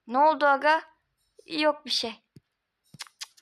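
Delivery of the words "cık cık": normal talking voice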